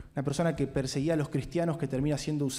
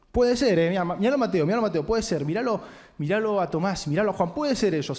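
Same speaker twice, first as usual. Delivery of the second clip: louder, higher, pointing the finger at the others; boastful Peter; 4 semitones higher, 4 dB louder than usual. Acted. Peter boasting to Jesus, pointing at others, loud mocking tone. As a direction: brag that anyone but him could betray.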